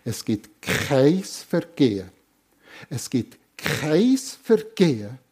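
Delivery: slow, weighty stress on kein Vergehen; preacher's emphatic declaration, slow; with great emphasis. Real, not acted. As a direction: lean hard on "kein", drawn out. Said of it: "das sage ich mit grosser Betonung".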